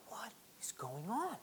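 hushed, confused whisper; bewildered whisper. Acted. Israelites baffled by defeat, whispering. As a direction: breathe it out in disbelief.

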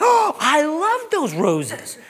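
high, slow, delighted mock wife; gushing wife voice, hammed up; a playful take on his wife's delight; imitating wife. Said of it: "you know what she said".

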